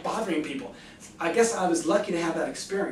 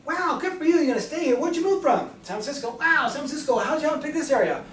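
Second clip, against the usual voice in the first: bright, much higher, eager phone voice; enthusiastic caller; 7 semitones higher than usual. Shown as a demonstration. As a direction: gush with enthusiasm at the homeowner. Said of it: "like I've just demonstrated with you right".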